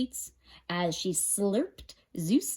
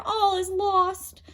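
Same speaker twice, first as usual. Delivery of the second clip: high slow despairing wail; 11 semitones higher, 3 dB louder, slower than usual. A narrator's reading. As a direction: wail it hopelessly. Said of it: "wailed Demeter".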